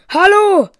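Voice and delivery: high, loud yell at the computer; screaming. A narrator's reading. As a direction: scream it at the screen. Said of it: "schreit sie ihr Computer an".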